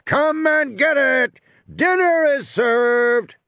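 high, drawn-out dinner call. Acted. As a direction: holler it out slowly, like summoning diners. Calling raptors like a dinner gong.

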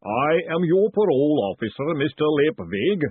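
booming golem voice. Acted. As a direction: boom it out solemnly. Golem introduces itself.

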